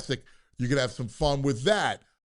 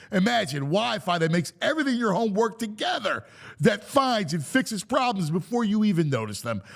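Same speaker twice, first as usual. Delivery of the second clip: ad read; 5 semitones higher than usual. Acted. Live read Xfinity ad.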